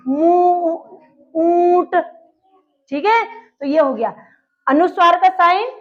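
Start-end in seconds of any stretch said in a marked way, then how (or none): 0.1-2.0 "मुंह", "ऊंट" with long, held nasal vowels; nose and mouth. Shown as a demonstration. Teacher shows anunasik, stretched nasal vowels in "मुंह" and "ऊंट". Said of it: "नाक और मुंह दोनों में जोर पड़ेगा".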